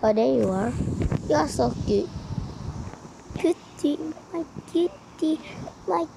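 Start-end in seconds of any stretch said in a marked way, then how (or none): 3.4-6.1 soft, higher cooing. Real, not acted. Player coos at a cute character, soft and high. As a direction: coo over it like a pet.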